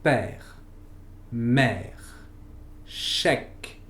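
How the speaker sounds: "père, mère, chèque." with an open è; French open è. Shown as a demonstration. Teacher modeling open è words for learners, careful French. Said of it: "Por ejemplo".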